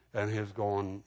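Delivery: ordinary talk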